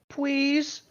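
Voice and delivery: high lisped pwease; mock childish please. Mocking Gabrielle begging Harry, high baby lisp.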